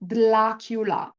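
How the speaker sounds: Dlacula said slowly with a clear L; L in place of r. Shown as a demonstration. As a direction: Dlacula with the tongue tip at the front. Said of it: "replace the letter R in Dracula with the letter L".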